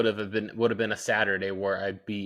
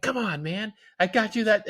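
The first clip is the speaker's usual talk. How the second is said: mock pleading; 9 semitones higher, 3 dB louder than usual. Father pleading with toddler.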